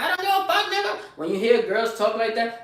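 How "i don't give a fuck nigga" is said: high mocking girl voice, with a laugh. Mocking girls who talk like that, high girl voice. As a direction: mimic a girl snapping back, high pitched.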